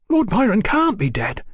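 high, distressed outburst; bursting out. A narrator's reading. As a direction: burst out in disbelief. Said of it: "Brian burst out".

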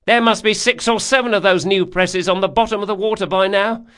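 high, loud, gossipy rower; boatman character. Acted. Boatman telling his passenger about the ambushes, high and loud. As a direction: explain it in a high, loud working voice.

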